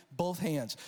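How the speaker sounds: ordinary talk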